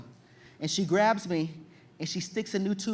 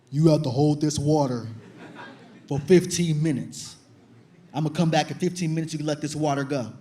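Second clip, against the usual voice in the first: stern nurse, deeper voice; 5 semitones lower than usual. Retold or imitated. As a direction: give orders like a tough deep-voiced nurse. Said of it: "she's like".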